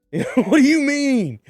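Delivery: high, loud, slow squawk of disbelief; mock outraged dealer. The dealer asking why his shrooms were thrown away, high mock outrage. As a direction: play the wronged dealer, outraged. Dealer voiced in retold quarrel.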